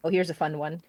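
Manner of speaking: ordinary talk, nothing marked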